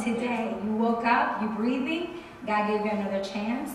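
ordinary talk

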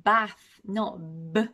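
"bathのブ" slow, with a popped B; emphasized B. Shown as a demonstration. Teacher pointing out the key sound, emphasized B. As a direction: burst the B of "bath" from closed lips. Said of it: "ここで注意したいポイントは".

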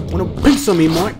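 loud, high mock-Australian challenge; Australian accent. Gamer challenging Mike to a fight, hammed-up Australian accent. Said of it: "that was my Australian accent".

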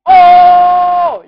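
high, very loud drawn-out "oh"; long loud yell. Real, not acted. Gamer before telling opponent he got served, long loud yell. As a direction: hold the yell at full volume. Big reaction before a taunt.

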